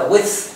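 "with" ending in a voiceless th; "with" with a hissed final th; second pronunciation. Shown as a demonstration. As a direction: finish "with" on a puff of air, no buzz. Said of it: "phát âm theo cách thứ hai".

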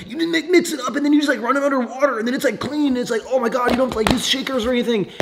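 high, hurried imitation of a gushing friend; Joe. Imitating the friend praising his frother, high mocking voice. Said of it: "that's how he talks".

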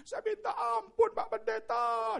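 very high, slow, drawn-out cry; wailing plea. Retold or imitated. Wheelchair user begging the pastor for forgiveness, wailing. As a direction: wail loudly for forgiveness. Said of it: "dia meratap".

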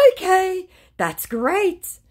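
very high, bright put-on okay; mock scammer okay. Scammer brushing off her answer, mock gushing. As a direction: gush with empty enthusiasm.

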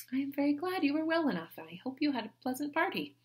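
be politely glad; Anne polite. A narrator's reading.

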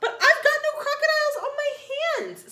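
very high, giggly princess voice; cartoon princess. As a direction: giggle it out like a little girl. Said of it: "said the little princess".

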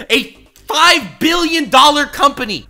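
five billion hit high, loud and slow; emphatic disbelief. Real, not acted. Stunned emphasis on the figure.

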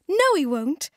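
crying out. Acted. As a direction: cut him off brightly. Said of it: "cried Fizz".

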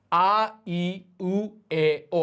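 আ, ই, উ, এ, ও with a gap after each; vowel drill. Shown as a demonstration. Teacher has learners read along, each vowel spaced out. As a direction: model আ ই উ এ ও for repeating. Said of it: "আপাতত আমার সাথে একসাথে পড়েন".

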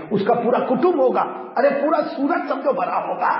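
urgent high-pitched storytelling; dramatic build-up. Real, not acted. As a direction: build the crowd scene to a peak. Building the Judgement Day scene.

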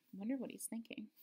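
ordinary delivery, nothing marked

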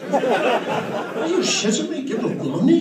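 hammed-up Josh, horrified at parting with money; outraged Josh. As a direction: play Josh losing it over a tip. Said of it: "Josh went out of his mind".

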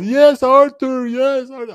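high, loud mock cheer; excited cheering. Co-host imitating a boxer's wife at the decision, excited. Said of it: "she's all excited".

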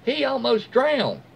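hammed-up outraged exclamation; high, slow, incredulous mock yell; exasperated outburst. Angry lifeguard confronting mother.

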